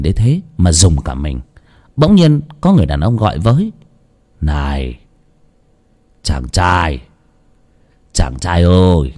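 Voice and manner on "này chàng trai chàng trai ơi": put-on low, slow old man calling out; a low old voice reaching out to a passer-by. A narrator's reading. Ông lão gọi với. (giọng ông lão trầm, chậm gọi với)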